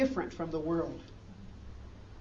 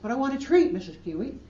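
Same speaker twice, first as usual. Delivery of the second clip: lightly voiced little girl, higher and louder on the demand; demanding child; 6 semitones higher, 7 dB louder than usual. Girl insisting to her teacher that she wants a treat, higher and louder. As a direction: push for the treat like a spoiled kid.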